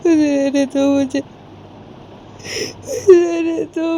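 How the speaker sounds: high, drawn-out tearful wail; Sanju sobbing. Acted. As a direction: plead slowly through acted sobs. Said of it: "Sanju ऐसे रोते रोते माँ को बोलती है". (रोते-रोते, खिंची हुई ऊँची आवाज़)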